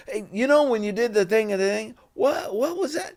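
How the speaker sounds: quick, high-pitched baffled question; climbing pitch, retold puzzlement; confused audience member. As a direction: imitate a fan fumbling for the scene. Imitates another confused audience member.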